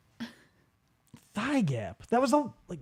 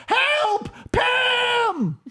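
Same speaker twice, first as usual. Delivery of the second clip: mock cry for help; 12 semitones higher, much slower than usual. Comedian voicing the clerk dragged into the TV, mock panicked cry. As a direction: wail for help, half panicked, as if being grabbed. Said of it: "and she's like".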